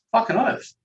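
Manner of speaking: sudden high exclaimed burst; emphatic yes. Real, not acted. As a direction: fire it out, proud and certain. Emphatic answer to own question.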